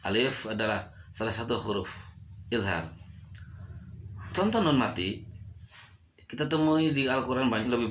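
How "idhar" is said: ordinary talk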